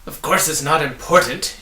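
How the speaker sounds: put-on loud, biting snarl at the children; angry snarl. A narrator's reading. Villain snarling at Milo and Tock, angry. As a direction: snap it out with contempt. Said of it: "he snarled angrily".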